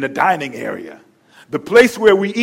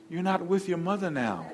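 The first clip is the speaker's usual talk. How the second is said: much softer, sweet put-down; a wife softly reminding her husband; sweet reminder; 2 semitones lower, 14 dB quieter than usual. Retold or imitated. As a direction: gently set him straight. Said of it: "so sweet ... cocks her head".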